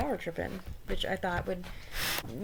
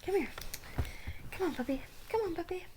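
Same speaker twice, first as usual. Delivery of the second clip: high-pitched; 11 semitones higher, 4 dB quieter, faster than usual. Real, not acted.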